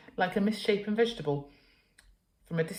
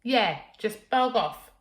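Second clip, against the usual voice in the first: slow, loud, cheeky "bog off"; rude retort; 5 semitones higher, 6 dB louder, slower than usual. Acted. Girl cheeking her mother through the door, loud. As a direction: sneer it back at mum.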